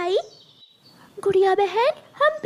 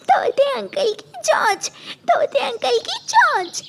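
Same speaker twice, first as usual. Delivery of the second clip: laughing; 4 semitones higher than usual. Acted. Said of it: "Kuku हंसने लग जाता है".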